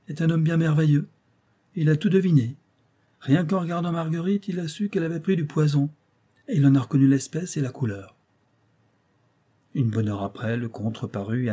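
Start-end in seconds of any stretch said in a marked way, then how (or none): 0.1-8.2 higher, louder mock admiration; slightly ironic. A narrator's reading. Dagobert on the count's guesswork, slightly ironic. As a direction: praise him with light irony. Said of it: "d'un air un peu ironique".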